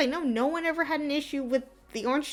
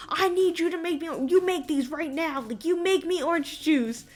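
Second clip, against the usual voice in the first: demanding mock yell; 7 semitones higher, 3 dB louder than usual. Retold customer demanding juice.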